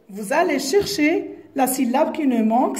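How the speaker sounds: normal talking voice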